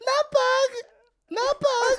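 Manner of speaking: very high, loud mock cat call; imitating high-pitched call. Cohost copies the owner's cat call, high-pitched imitation. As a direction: mimic his squeaky high call, loudly. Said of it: "let me try like he did".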